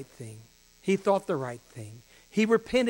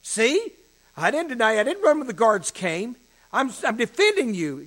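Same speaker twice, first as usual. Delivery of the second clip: high, loud self-justifying protest; acting out an insistent, over-eager disciple; emphatic declaration; 6 semitones higher, 9 dB louder than usual. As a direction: protest your loyalty loudly, pitched up. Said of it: "He is demonstrating his declaration".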